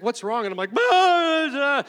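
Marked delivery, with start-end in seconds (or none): 0.0-0.5 higher, louder concerned question from the wife; worried wife. Retold or imitated. Wife finding her husband weeping, asking what's wrong. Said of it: "she's like". 0.7-1.8 much higher, much louder mock wail of "bah"; imitating blubbering sobs. Pastor imitating his own weeping for his wife, loud mock wail. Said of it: "like, just weeping".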